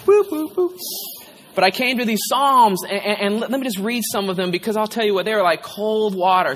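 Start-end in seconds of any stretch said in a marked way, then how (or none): 0.1-0.8 high-pitched little boops; light, high nonsense beeps; playful booping. Real, not acted. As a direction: shake off tears with silly boops. Playful noises to break tearful moment.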